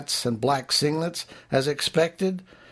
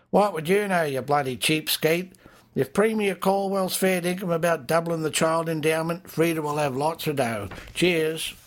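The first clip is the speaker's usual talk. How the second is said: higher, mock-indignant bar bloke; pub drinker; 6 semitones higher than usual. Drinker mocking a mate as a cheapskate, higher and jovial.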